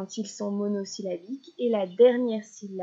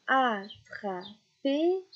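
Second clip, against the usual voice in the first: "Attraper" very slowly in three beats; syllable by syllable; much slower than usual. Shown as a demonstration. Teacher helping a child spot the last syllable, "Attraper" slowly split. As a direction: separate "Attraper" into at... tra... per. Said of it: "repérer ces dernières syllabes".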